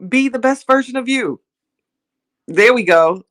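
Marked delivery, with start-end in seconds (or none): 0.1-1.4 high, smooth inspirational phrasing; smooth motivational delivery. Shown as a demonstration. Showing viewers how smoothly she gets back on topic. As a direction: glide back in like a polished speaker. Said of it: "See how I just came back in? See how that was smooth?".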